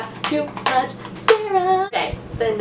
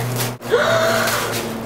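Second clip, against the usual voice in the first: shrieking gasp. Real, not acted. Spotting the next gift.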